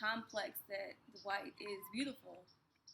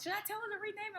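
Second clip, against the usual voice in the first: high worried question; 10 semitones higher, much faster than usual. Retold or imitated. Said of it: "And then Liz was like".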